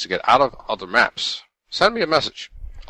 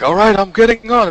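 excited; 12 semitones higher, 6 dB louder than usual. Retold or imitated. Partner voiced in excited retelling.

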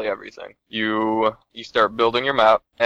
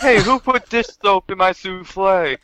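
high, indignant mock chef; outraged chef; 9 semitones higher, 4 dB louder than usual. Pretend chef angry over his ruined souffle, high and indignant. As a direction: play the wronged cook, pitch up.

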